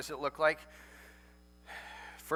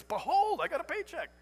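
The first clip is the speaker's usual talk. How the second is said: mock grandeur; 13 semitones higher, 4 dB louder than usual. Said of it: "Nobody gets their paycheck and says".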